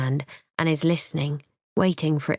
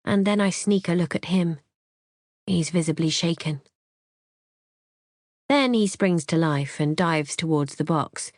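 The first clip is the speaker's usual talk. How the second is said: plain reading voice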